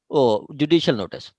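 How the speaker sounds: usual speaking voice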